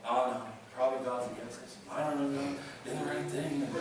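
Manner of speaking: low, soft gloomy mumbling; mock defeated. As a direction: sink into hammed-up self-doubt, quiet and low.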